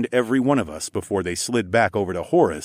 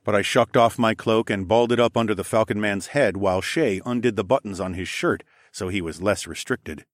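ordinary delivery

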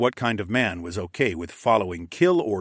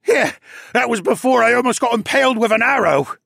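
high, loud, indignant comeback; agitated captive retorting; heated retort; 13 semitones higher, 7 dB louder than usual. A narrator's reading. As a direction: fire back loudly in a high panicky voice. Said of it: "he retorted".

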